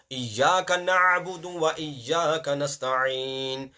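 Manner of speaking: slow, careful recitation with a clear ayn. Shown as a demonstration. Teacher repeating the verse slowly for the class. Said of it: "Ayn is very important".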